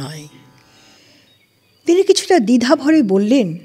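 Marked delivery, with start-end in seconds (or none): none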